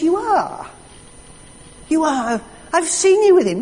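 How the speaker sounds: put-on high, insistent accusing girl; accusing servant girl. Servant girl pressing Peter by the fire, high insistent accusation. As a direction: jab the accusation at him, pitched high.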